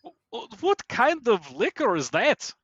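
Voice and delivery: high, put-on Romanian accent; hammed-up, stuttering Eastern European accent; muddled Romanian accent. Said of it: "I don't even know what that accent was".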